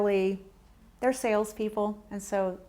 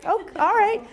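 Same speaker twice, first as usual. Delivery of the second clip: surprised; 11 semitones higher, 7 dB louder than usual. Real, not acted. Surprised reaction to honest answer.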